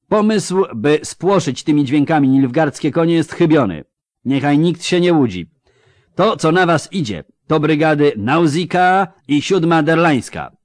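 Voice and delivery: loud, mocking commander addressing his troops; drwiący wojewoda. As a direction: sneer at the pikemen like a scornful noble officer. Voivode speech continues. (szyderczy wojewoda przemawia głośno do pikinierów)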